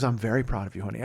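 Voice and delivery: low, soft imitation of wife; patronizing praise. Wife humouring husband over his story, patronizing praise. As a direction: soothe him condescendingly, quietly.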